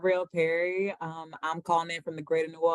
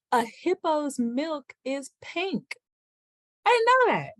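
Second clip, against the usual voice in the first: much higher and louder with each line, rising wonder; amazed; 12 semitones higher, 6 dB louder than usual. Real, not acted. Panelist sharing a fun fact with the group, climbing, squealing amazement.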